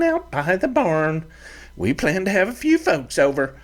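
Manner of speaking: high, gentler ranch mother voice; fiery mother turned kindly; softened mother. Acted. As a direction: ease off the scolding, offer supper. Mother softens, offers food.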